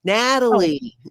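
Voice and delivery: high, loud Sandy voice; Sandy McKenna impression. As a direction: call the name like Sandy. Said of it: "I just have to channel Sandy McKenna".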